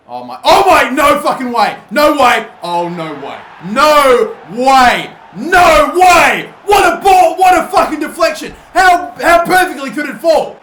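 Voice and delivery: loud, high shouts of no way; disbelieving yell. Real, not acted. Gamer shouting in disbelief at a last-minute goal, shocked yell. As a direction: scream in shock at the late goal.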